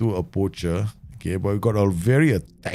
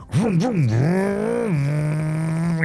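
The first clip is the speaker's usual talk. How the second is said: higher, stretched engine noises; engine vroom; 5 semitones higher, much slower than usual. Retold or imitated. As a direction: imitate a flashy car revving. Kid driving his BMW.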